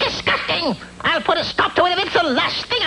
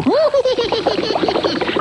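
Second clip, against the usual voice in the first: witch cackle; 4 semitones higher than usual. Acted. Witch at her brew.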